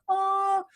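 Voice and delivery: high squealing "Ah!" of thrill; excited gasp. Retold or imitated. New car owner squeals with joy, high excited gasp. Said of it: "next day you get into".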